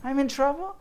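very high, incredulous echo question; imitating a startled patient; surprised. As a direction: shoot the pitch up in mock surprise. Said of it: "she looked at me with surprise".

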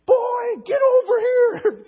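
high, drawn-out yell across a gym; angry teacher's shout. Retold or imitated. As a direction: holler a kid over from far off.

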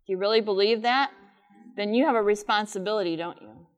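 ordinary talk, nothing marked

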